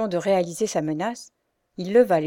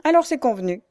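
higher, louder smirking jab; sneering snicker; 6 semitones higher, 5 dB louder than usual. A narrator's reading. Bully sneering at Pierre, a high smirking jab. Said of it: "ricana le chérubin".

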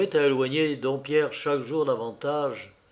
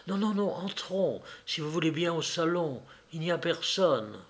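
softer, higher, confidential; hushed; 4 semitones higher, 6 dB quieter than usual. Acted. Woman leading the clergyman to the salon, hushed. She steers him away quietly.

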